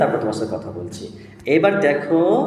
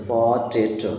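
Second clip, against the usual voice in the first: Potato in three even beats; three separate syllables; 2 semitones lower, much slower than usual. Shown as a demonstration. Teacher shows a child three syllables, one by one. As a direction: break potato into three parts. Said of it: "এখানে তিনটে syllable আছে".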